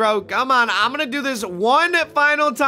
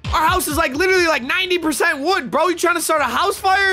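higher-pitched incredulous telling-off; exasperated scolding; 6 semitones higher, faster than usual. Acted. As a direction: scold the kid in disbelief. Dad scolds over candles.

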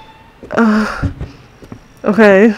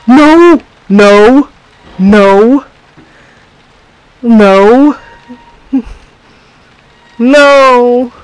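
shouted frightened no; loud panicked refusals; loud scared no; 4 semitones higher, 8 dB louder, much slower than usual. Real, not acted. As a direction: yell no at the screen. Scared, refusing in horror game.

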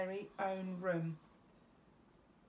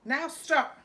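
much louder, higher order; loud command; 7 semitones higher, 12 dB louder than usual. A narrator's reading. Max ordering the wild things to stop. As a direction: stop them in their tracks. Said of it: "Max said".

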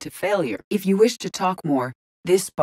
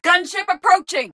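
high-pitched warning call; raised, high battle cry; urgent combat warning; 14 semitones higher, 3 dB louder than usual. Acted. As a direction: call out incoming danger urgently.

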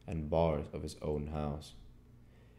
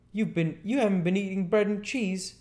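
high, loud character voice full of astonishment; amazed Marvel; 7 dB louder than usual. A narrator's reading. Marvel questions the invisible man about his meal, amazed. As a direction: ask it high and incredulous.

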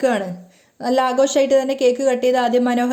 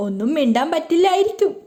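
lightly teasing jab, pitched higher; mocking; 4 semitones higher than usual. A narrator's reading. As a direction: poke fun at the son, half-joking. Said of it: "കളിയാക്കിക്കൊണ്ട് പറയാണ്". (കളിയാക്കുന്ന സ്വരത്തിൽ)

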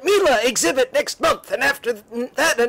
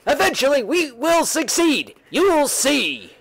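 usual speaking voice

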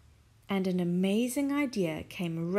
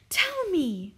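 eager cry; 10 semitones higher, 4 dB louder than usual. Acted. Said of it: "cried Missus Silver".

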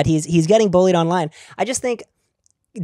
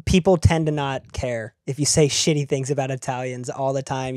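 ordinary delivery

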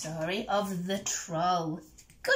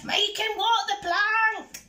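high, loud yelled order; pirate yell; 11 semitones higher, 5 dB louder than usual. A narrator's reading. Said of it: "yelled Ben Buckle and Percy Patch".

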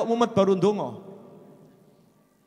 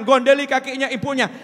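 ordinary talk

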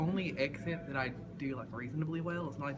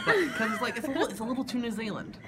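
light Australian accent that slides toward New Zealand, a bit higher; a half-laughing Australian accent try; 9 semitones higher, 5 dB louder than usual. Shown as a demonstration. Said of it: "the Australian accent".

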